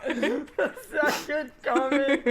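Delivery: high, drawn-out echo through laughter; laughing echo. Real, not acted. Co-host echoing the phrase, laughing. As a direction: repeat it slowly, cracking up.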